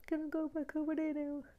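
high, timid little dog voice answering the owner; pet's voice. Pretend. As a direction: speak for the puppy, small and shy. Pet asks to leave.

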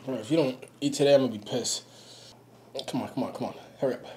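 talking with a burning mouth; urgent, mouth on fire from spice; mouth burning from spicy food. Real, not acted. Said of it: "My mouth is burning here".

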